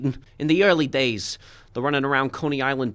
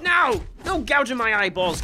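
panicked shout; 7 semitones higher than usual. Acted. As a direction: shout him off your eyes. Brawl, eye gouged.

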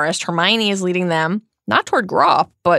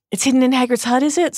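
eager; 7 semitones higher, 3 dB louder, faster than usual. A narrator's reading. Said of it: "said Umbridge eagerly".

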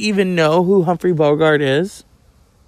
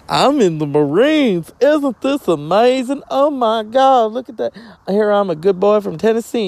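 higher, louder, gushing host voice; Huell Hauser; 7 semitones higher, 4 dB louder than usual. Retold or imitated. Vlogger voicing the TV host, loud gushing wonder. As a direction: gush like a wide-eyed TV tour host. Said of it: "stories about Huell Hauser".

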